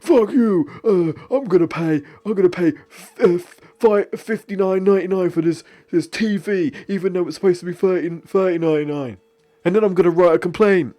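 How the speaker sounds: angry Homer. Retold or imitated. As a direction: rant like a cartoon dad who overpays. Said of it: "Homer comes and is like".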